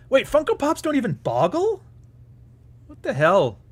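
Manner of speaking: high surprised outburst; suddenly realizing, voice jumping up; startled question, pitched high. Real, not acted.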